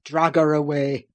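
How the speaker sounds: low, loud savage order; savage cry. A narrator's reading. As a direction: spit the order. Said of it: "he cried savagely".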